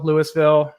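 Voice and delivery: Louisville said as LOO-iss-vil, with the S sounded; alternative pronunciation. Shown as a demonstration. Guest listing another way for the host, S sounded as LOO-iss-vil. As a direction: say Louisville like Lewis-ville. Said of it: "pronounce it how you want".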